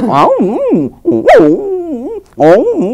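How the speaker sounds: high, loud yelping dog greeting a familiar person; eager dog whining hello; friendly dog whine. Retold or imitated. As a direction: whimper and yip like a dog that knows you. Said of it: "కుక్క మనం చూడగానే పరిచయం ఉన్న వాళ్ళు అయితే".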